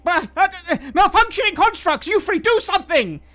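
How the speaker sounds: high, loud alarmed yell; frantic, loud order; panicked shout. Acted. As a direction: yell at the three to act.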